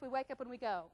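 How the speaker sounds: normal talking voice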